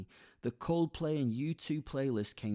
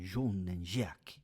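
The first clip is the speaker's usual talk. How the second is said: French accent; 3 semitones lower, much slower than usual. Shown as a demonstration. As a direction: drawl the names like a Frenchman, "Zhon and Zhack". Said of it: "like, a French accent".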